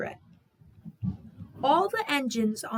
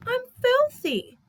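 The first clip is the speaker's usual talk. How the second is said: dismayed little engine; 13 semitones higher, 5 dB louder than usual. A narrator's reading. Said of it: "Percy felt awful".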